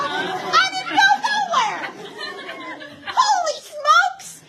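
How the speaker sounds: high, slow, laughing disbelief; drawn-out amazed laughing exclamation; stunned amazement. Real, not acted. Shocked at the fake ID.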